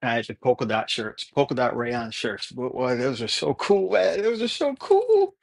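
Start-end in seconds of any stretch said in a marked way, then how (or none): 2.5-5.3 squeaky, high mock-excited praise; mock enthusiasm. Real, not acted. Mocking his old cool shirts.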